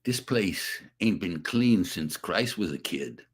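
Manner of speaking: low, slow tough-guy delivery; a touch of street tough; gruff tough-guy line. Retold or imitated. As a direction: deliver it like a hard-bitten cop. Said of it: "Say this line".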